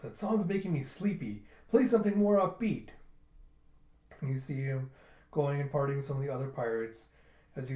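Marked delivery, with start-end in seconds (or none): 0.0-2.9 higher, louder complaint; mock complaint. Pirate tells the musician to play something upbeat, mock complaint. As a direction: call out a peeved demand.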